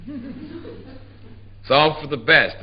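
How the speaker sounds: very slow, drawn out with a laugh. Acted. Husband telling his wife over the dead body, slow laughing. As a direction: say it with a sly chuckle. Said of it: "I told her".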